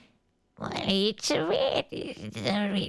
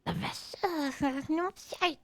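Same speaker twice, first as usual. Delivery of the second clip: ordinary talk, nothing marked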